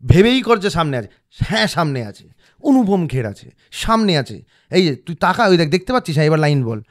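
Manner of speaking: coaching yourself hard, near shouting; loud self pep talk. Retold or imitated. Rehearsing alone, he loudly urges himself to imagine Anupam Kher. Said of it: "এবার বলার পর বললাম".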